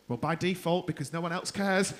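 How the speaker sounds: ordinary delivery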